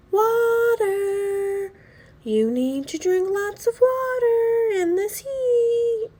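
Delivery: high, loud call across the pool; mother calling out. Acted. Mother calling the boys at the pool to drink water, high loud call. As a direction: call out so the boys hear you over the splashing. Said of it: "Ty's mother calls".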